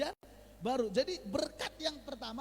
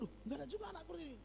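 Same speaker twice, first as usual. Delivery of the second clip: surprised mother; 5 semitones higher, 7 dB quieter, much faster than usual. Retold or imitated. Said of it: "Baru ibunya sadar".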